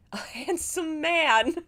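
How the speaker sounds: high, slow, swooning gush; mock swooning. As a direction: gush dreamily over him. Reader swoons over the love interest.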